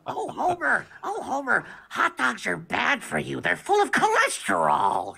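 high cartoon wife voice, scolding gently; a fussing cartoon wife answering her husband; Marge Simpson. On the school microphone, answering Homer in a high Marge voice. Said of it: "And then I'd be like".

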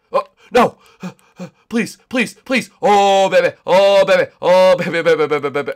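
high, loud panicked pleading; loud frantic begging then cheering. Real, not acted. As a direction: yell in real panic then relief. Nearly burning in lava.

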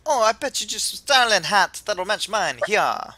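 loud, hammed-up Jamaican accent; attempted Jamaican accent. Kukui admires the player's hat, attempted Jamaican accent. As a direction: do a breezy bad Jamaican voice. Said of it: "I know I can't do Jamaican".

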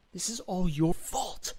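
high, drawn-out blame without shouting; anger held below a yell; acted furious accusation. A narrator's reading. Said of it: "but I'm also furious".

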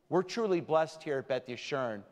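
usual speaking voice

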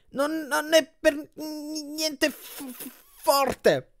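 very high, slow, juddering voice; vibrating moan. A narrator's reading. Grover moans while a million hands massage him, voice vibrating. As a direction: stutter it high as if shaken by a massage machine. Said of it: "la voce che vibrava per via del massaggio".

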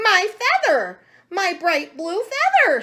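loud, high outraged squawk; squawking chicken. A narrator's reading. Chicken Chuck has lost the feather, squawking. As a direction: squawk it like an upset hen. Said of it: "squawked Chicken Chuck".

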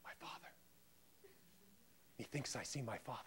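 hushed, low and quick; Hamlet seeing ghost. Acted. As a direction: breathe it softly, confronted by a ghost. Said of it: "Hamlet, confronted with his father's ghost".

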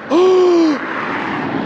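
long, high gasp of shock; sharp intake at a shocking find. Real, not acted. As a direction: gasp in real disbelief. Discovers a gun on the magnet.

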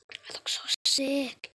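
slow unvoiced whisper. Gamer eyeing his sickly avatar, slow whisper. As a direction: hush it, slow and breathy.

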